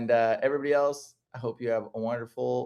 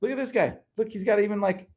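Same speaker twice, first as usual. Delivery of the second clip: excited; 9 semitones higher than usual. Real, not acted. Excitedly showing elf ear photos.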